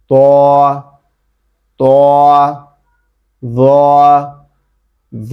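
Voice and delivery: সদ, দদ, ত, য held long and heavy; sustained emphatic Arabic letters, সদ to য, each repeated; closed-mouth thick letters. Shown as a demonstration. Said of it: "বন্ধ হয়ে আসবে".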